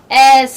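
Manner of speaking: "S" stretched into a long, loud hiss; loud S with a long trailing sss; drawn-out letter. Shown as a demonstration. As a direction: hold the final sound of "S" till it fades. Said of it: "अब S को खींच कर बोलिए".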